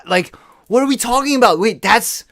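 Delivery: indignant disbelief; incredulous outrage. Real, not acted. Ranting about police photo lineups, high and loud.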